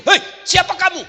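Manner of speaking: a high, loud startled challenge; startled cry. Retold or imitated. Husband startled by a creature rising from the well. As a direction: jump back and call out in shock.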